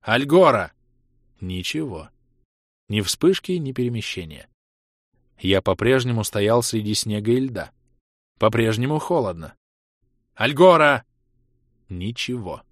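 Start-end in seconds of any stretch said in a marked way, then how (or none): 0.0-0.7 loud call of a place name; shouted command. Acted. Ros shouts a teleport destination. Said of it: "крикнул". 10.3-11.0 loud call of a place name; shouted command. Acted. Ros shouts a teleport destination. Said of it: "крикнул".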